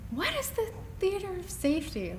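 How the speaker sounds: high-pitched, wondering question; lifted, incredulous musing; puzzled wondering. Retold or imitated. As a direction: ask it as if baffled by the phrase. Puzzled question posed in her thinking.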